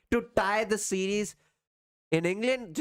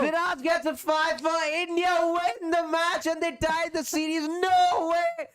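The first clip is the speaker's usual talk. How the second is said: excited; 9 semitones higher, slower than usual. Real, not acted. Match-winning ball, live reaction.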